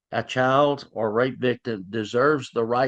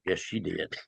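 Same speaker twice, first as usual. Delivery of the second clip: lower, softer, conceding quietly; grudging admission; 6 semitones lower, 10 dB quieter than usual. Retold or imitated. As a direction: give in under your breath. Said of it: "She goes".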